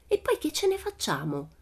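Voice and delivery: worried high-pitched question; alarmed. A narrator's reading. Tommy asking Pippi what they'd do with him, alarmed. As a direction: object anxiously, voice well up. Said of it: "la guardarono terrorizzati".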